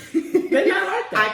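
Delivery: high laughing squeal. Real, not acted. Teasing friend.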